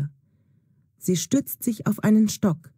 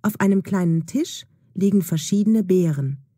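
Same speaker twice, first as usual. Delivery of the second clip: ordinary delivery, nothing marked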